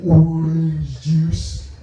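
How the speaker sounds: drawn-out first words, very slow; slow stunned child voice; slow mock toddler. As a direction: say it like a kid speaking for the first time. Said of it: "And I'm like".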